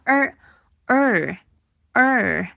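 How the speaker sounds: "儿" as a held American er; separated, sustained r-coloured vowel on "儿"; curled-tongue R. Shown as a demonstration. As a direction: curl the tongue on each "儿". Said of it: "卷舌发儿".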